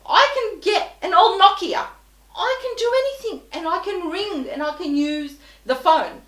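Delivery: screaming at the phone agent. Retold or imitated. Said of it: "I'm screaming into this phone".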